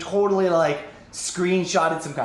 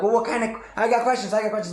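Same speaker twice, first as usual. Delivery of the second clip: clamoring questions; 6 semitones higher, faster than usual. Retold or imitated.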